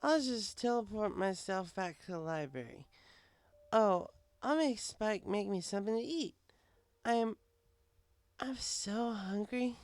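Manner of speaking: high, slow slurred plan; high, slow drunk mare whining she's hungry; drunk whine. Acted. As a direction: whine about being hungry, tipsy. Drunk Twilight wants food.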